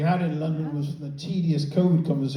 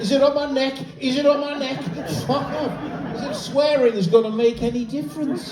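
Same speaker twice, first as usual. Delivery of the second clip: mental panic; 9 semitones higher than usual. Acted. British person with a wasp nearby, panicked shouting. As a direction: panic in a raised, high-pitched voice. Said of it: "British people go mental".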